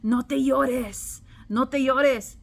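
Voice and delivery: lightly voiced commanding man; man's voice. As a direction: imitate a man ordering it in Spanish, drawn out. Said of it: "I heard a man's voice go".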